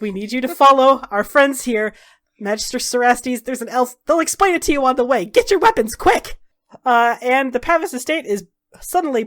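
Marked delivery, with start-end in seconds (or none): 0.0-6.4 cepat, makin tinggi dan keras; urgent orders. Acted. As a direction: perintah terburu-buru yang memuncak. Dorian urgently briefs Talgan.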